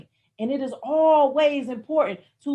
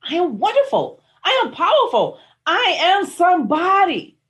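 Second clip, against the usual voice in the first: louder, higher, declared with full conviction; affirmation with conviction; 7 semitones higher, 7 dB louder than usual. Shown as a demonstration. Coach shows listeners how to say affirmations, loud proclaiming. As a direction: proclaim it like you believe it. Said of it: "say it with such love and such conviction".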